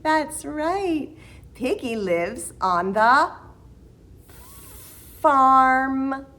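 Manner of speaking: ordinary delivery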